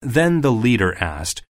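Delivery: usual speaking voice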